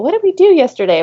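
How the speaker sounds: high-pitched mock student query; imitating students. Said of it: "would have students come to school".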